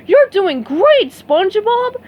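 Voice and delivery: root for your buddy; cheering. A narrator's reading. Patrick cheering SpongeBob's practice, loud cheer. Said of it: "Patrick cheered".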